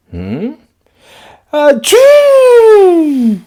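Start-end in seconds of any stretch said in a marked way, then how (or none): 1.5-3.4 a loud, high, explosive achoo; big sneeze. Acted. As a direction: blast out a huge cartoon sneeze. Gerald sneezes at Piggie.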